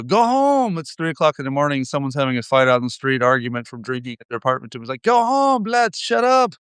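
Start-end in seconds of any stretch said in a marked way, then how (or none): 0.0-0.8 high, drawn-out call; mock window yell. Resident yelling down at late-night street fighters, mock yell. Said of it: "I yell out the window". 5.0-6.5 high-pitched scolding call; mock window yell. Resident telling drunken arguers below to shut up, mock yell. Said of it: "I yell out the window".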